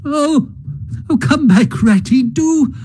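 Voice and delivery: high, tearful pleading call; pleading cry. A narrator's reading. Mole begs Rat to turn back, high and tearful. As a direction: beg him to come back. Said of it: "cried the Mole".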